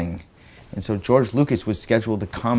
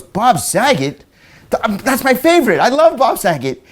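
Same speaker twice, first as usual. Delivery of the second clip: gushing fan daughter; 11 semitones higher, 4 dB louder than usual. Retold or imitated. Daughter gushing over Bob.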